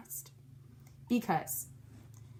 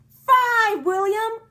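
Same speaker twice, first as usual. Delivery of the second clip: high, loud cheer; shouted; 13 semitones higher, 9 dB louder, slower than usual. A narrator's reading. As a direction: shout the count. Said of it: "we shouted".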